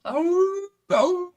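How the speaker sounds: high, loud wolf-like howl; soaring imitation wolf howl. Shown as a demonstration. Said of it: "like, a wolf howl".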